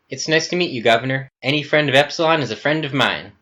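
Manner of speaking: high-pitched, polite newcomer voice; keen, courteous introduction; eager polite greeting. Acted. Kenneth politely greets the intimidating Governor.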